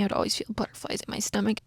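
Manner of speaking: normal talking voice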